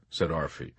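ordinary delivery

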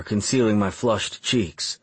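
plain reading voice

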